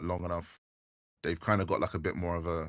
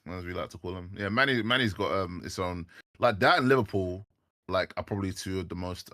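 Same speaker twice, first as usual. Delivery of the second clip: ordinary talk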